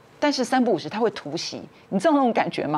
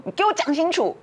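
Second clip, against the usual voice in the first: mock yell, much higher; hammering out the sister's demand; imitating a shout; 9 semitones higher than usual. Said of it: "他就喊我先生的名字". (模仿姑姑高声喊叫质问)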